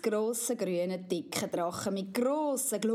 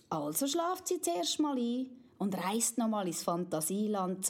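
ordinary delivery

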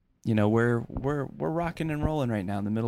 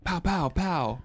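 mock gunfire noises; 7 semitones higher, much slower than usual. Imitated weapon fire.

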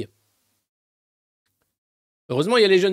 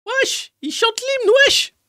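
mocking street youth; 4 dB louder, much slower than usual. Mimicking youth at July 14.

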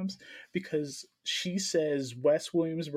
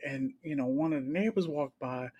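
ordinary talk, nothing marked

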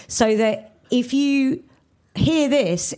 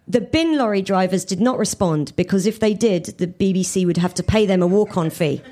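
ordinary delivery

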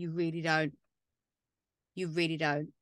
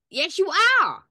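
mock retort; 9 semitones higher, 9 dB louder than usual. Retort to quoted denial.

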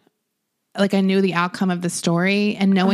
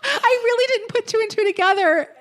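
laughing at yourself, pitch climbing high; high, giggly confession through laughter; 13 semitones higher than usual. Real, not acted. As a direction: crack up while admitting it. Laughing at own slow realization.

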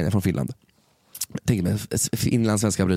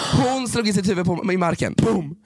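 higher, urgent storytelling; excited retelling; 8 semitones higher than usual. Real, not acted. Telling friend about the fall, urgent and high. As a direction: tell it with mounting panic.

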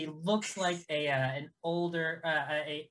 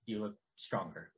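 lowered, softened character voice; measured character greeting; 4 semitones lower, faster than usual. Acted. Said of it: "he approaches".

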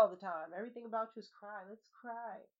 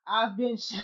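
louder stand-up sign-off; comedian voice; 10 dB louder than usual. Pretend. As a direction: sign off like a comic. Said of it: "in my comedian voice".

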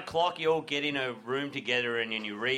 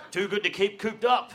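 quoting a slogan; 5 semitones higher than usual. Retold or imitated. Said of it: "I used to say all the wild turkey slogans".

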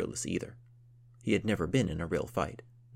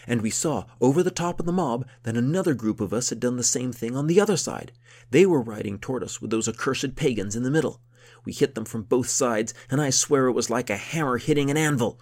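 rising louder and higher into a war story; caught up in the tale; 6 semitones higher, 9 dB louder than usual. A narrator's reading. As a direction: relive the charge as if you're back there. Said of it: "as the Count got into his story".

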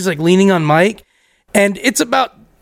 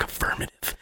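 very soft, hushed retold practice; voiceless mock-cool whisper; whispered rehearsing; 17 dB quieter than usual. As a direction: try out a cool word under your breath.

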